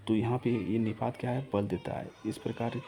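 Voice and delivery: normal talking voice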